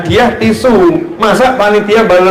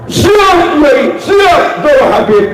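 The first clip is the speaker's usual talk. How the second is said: eager yes; 8 semitones higher, slower than usual. Retold or imitated. Student answers kiai's phone call, eager and high.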